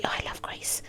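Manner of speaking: soft breathy aside, barely voiced; a hushed gush of affection; whispered aside. Real, not acted. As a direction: whisper it fondly to yourself. Fond whispered aside about Grace.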